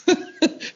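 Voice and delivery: quick breathy laugh; short laugh. Real, not acted. Remembering the name Big Rock, laughing.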